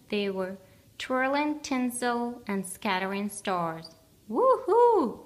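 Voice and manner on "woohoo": high, loud whoop; gleeful cry. A narrator's reading. Big Bear whoops tossing the star, high and loud. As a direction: whoop for joy. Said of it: "cried Big Bear".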